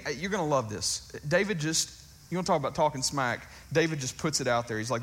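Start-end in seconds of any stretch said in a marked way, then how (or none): none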